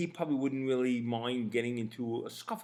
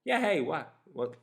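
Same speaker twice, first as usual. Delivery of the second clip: mildly clueless, higher-pitched opener; playing dumb; 5 semitones higher, 3 dB louder than usual. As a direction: act like you have no idea what's wrong. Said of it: "playing it dumb a little bit".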